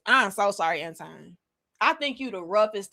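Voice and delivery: ordinary talk, nothing marked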